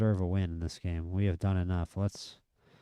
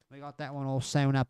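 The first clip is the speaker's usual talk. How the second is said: higher, half-Australian attempt at British; bad British accent; 5 semitones higher than usual. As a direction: fake a British accent and miss. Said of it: "I sound way more Australian".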